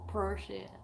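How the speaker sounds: low, soft imitation of a friend's accent; Mel's accent. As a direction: repeat it softly in your friend's accent. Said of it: "That's it".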